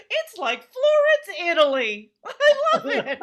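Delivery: loud, high mock gushing; hammed-up rapture over somewhere very ordinary; gushing diner. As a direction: squeal with exaggerated delight, voice way up.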